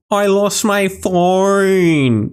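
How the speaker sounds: high, very drawn-out Australian caricature; slow, high, exaggerated Australian accent; mock Australian accent. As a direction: stretch the vowels in a hammed-up Aussie wail. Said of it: "imagine sounding like that".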